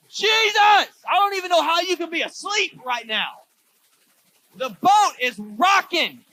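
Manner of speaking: high, loud, slow panicked pleading; panicked shout. Retold or imitated. Disciples shout to the sleeping Jesus, panicked. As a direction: yell over the storm in panic.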